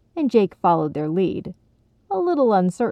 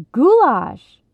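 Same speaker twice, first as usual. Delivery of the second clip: high, loud exclamation; exultant cry; exultant repeat; 7 semitones higher, 8 dB louder than usual. A narrator's reading. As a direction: proclaim it like praise to the gods. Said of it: "Ronnie repeated".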